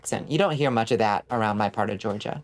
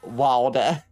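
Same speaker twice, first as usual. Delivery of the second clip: put-on New Jersey accent. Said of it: "Your New Jersey ass saying".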